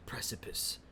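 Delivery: a hushed, dramatic whispered word. Real, not acted. Bragging about humanity, suddenly whispered. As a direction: drop to an awed whisper. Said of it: "dramatic build, whispered word".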